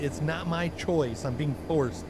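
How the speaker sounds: ordinary delivery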